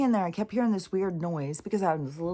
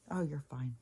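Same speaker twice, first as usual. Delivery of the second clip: soft reassurance; 7 dB quieter than usual. Retold or imitated. Inner voice reassures her.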